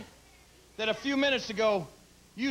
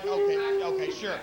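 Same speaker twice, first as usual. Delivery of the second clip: sarcastic; 8 semitones higher, slower than usual. Acted. Taunting rival in wrestling promo.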